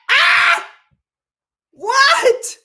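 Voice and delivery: high, loud shriek of disbelief; shocked shriek. Real, not acted. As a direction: yelp in horror at the set. Shocked reaction to the set.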